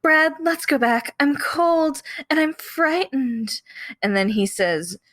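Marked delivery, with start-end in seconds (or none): 0.0-3.6 high, frightened Janet; a lightly frightened young woman, pitched up; Janet's voice. Retold or imitated. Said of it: "And Janet says".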